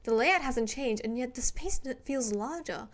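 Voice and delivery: ordinary delivery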